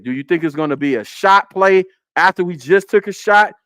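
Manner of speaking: ordinary talk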